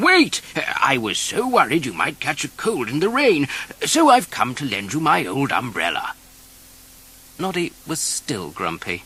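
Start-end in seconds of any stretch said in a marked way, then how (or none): 0.1-6.1 lightly voiced kindly old gnome, louder; a caring call down the road; Big Ears calling. Big Ears calls to Noddy with an umbrella, louder and caring.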